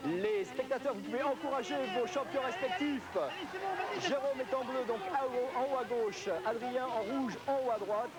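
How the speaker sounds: high, excited game commentary; live play-by-play. Acted. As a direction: call the race as it happens, climbing.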